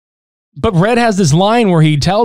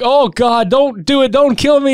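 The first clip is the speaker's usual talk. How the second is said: mock begging; 9 semitones higher than usual. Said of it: "He's like".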